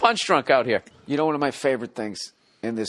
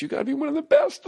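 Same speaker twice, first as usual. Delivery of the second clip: hammed-up crying squeak; mock tearful praise; faster than usual. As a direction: talk into a fake cry. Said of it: "and then you cry".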